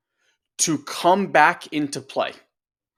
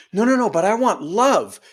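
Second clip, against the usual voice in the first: higher, louder whining plea; pleading self; 6 semitones higher, 5 dB louder than usual. Retold or imitated. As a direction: whine for what you want. But you're like.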